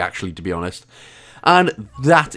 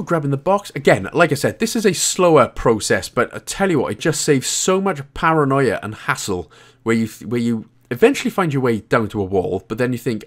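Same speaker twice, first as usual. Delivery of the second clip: ordinary talk, nothing marked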